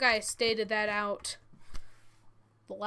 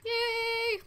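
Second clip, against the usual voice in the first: excited; 11 semitones higher than usual. Real, not acted. Happy he didn't have to cut.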